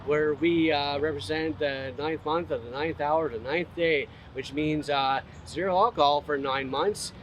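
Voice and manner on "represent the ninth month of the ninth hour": ordinary talk, nothing marked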